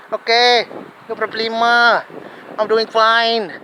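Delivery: mock Filipino accent with stretched, level phrase endings; Filipino or Chinese accent. Homeless newcomer saying he is fine, mock Filipino accent. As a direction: imitate a Filipino speaker, drawing out each last word. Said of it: "Something like this".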